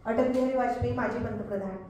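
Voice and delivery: ordinary talk, nothing marked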